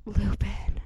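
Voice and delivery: hushed whisper of the name; hiding from a noise, the name whispered. Retold or imitated. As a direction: breathe the name so no one hears. They hear a noise, whisper.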